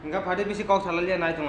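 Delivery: ordinary delivery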